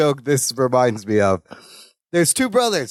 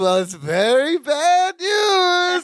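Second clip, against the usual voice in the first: very high, drawn-out mock wail; sing-song wail; 10 semitones higher, much slower than usual. Imitating Balky escalating the bad news to Larry, sing-song wail. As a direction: climb high and stretch the last words. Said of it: "hemming and hawing and sing-songing".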